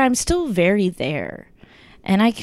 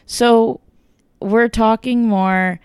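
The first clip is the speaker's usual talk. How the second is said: usual speaking voice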